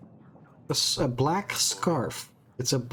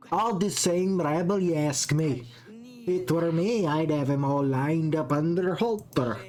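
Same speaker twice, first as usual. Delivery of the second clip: put-on a clumsy Scottish accent, scornful; bad Scottish accent. Hostile villager taunts the queen, bad Scottish accent. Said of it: "she's supposed to be Scottish".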